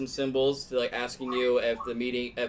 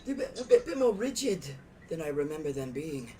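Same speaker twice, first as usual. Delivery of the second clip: higher, put-on character voice; NPC voice; 6 semitones higher than usual. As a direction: play a haughty elder.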